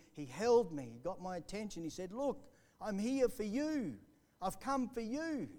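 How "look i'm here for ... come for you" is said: slow, higher, gentle voice of the Lord; tender divine voice. Retold or imitated. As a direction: say it slowly, gently calling him. Voicing God's call.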